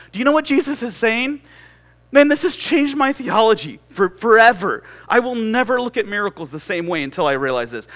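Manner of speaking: high, loud, fervent preacher voice; impassioned preaching. Real, not acted. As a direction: testify with rising urgency to the congregation. Preaches at full force.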